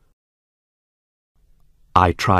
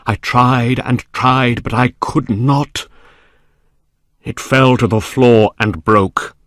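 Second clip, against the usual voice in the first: higher, louder, desperate storytelling; frantic narration; 6 semitones higher, 5 dB louder than usual. A narrator's reading. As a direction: build the panic of a failing struggle. Narrator frantically struggles with box.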